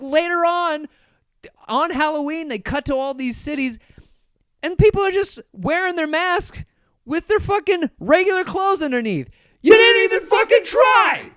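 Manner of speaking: rising from loud and high into a full shout; building angry rant. Real, not acted. Ranting at lazy costume wearers.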